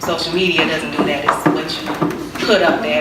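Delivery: normal talking voice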